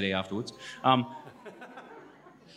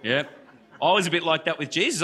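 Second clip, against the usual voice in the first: usual speaking voice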